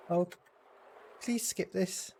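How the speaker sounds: ordinary talk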